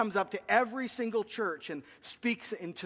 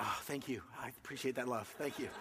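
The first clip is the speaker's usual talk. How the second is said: soft thanks; 7 semitones lower, 11 dB quieter than usual. Real, not acted. Responds to audience applause.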